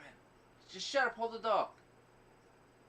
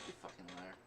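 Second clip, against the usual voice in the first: ordinary talk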